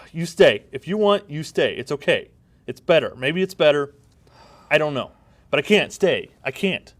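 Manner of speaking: hammed-up melodrama farewell; emotional goodbye. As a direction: push through a tearful parting. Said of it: "And scene".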